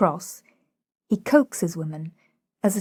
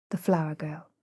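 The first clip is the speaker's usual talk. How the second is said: ordinary delivery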